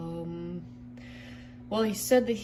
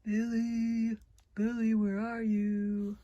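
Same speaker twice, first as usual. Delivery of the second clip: slow, drawn-out searching call; 5 dB quieter, much slower than usual. Acted. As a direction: sing out the name as if searching the house. Calling for Billy from another room.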